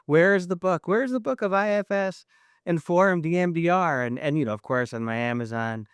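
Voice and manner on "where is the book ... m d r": higher, eager self-quote; eager search. Retold or imitated. [name] retells hunting Amazon for the book, eager and higher. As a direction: ask it like you're hunting for it. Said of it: "I'm like".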